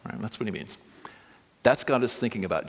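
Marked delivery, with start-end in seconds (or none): none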